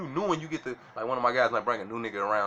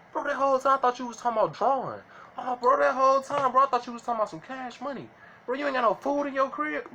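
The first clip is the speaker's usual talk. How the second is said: much higher, confused outsider voice catching on late; clueless outsider; 10 semitones higher than usual. Retold or imitated. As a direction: play the baffled outsider who finally gets it, pitched way up. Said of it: "they'd be listening like".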